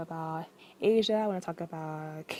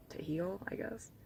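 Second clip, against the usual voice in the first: breathy whisper; 15 dB quieter than usual. Real, not acted. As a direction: whisper it gently. Soft ending of a reflection.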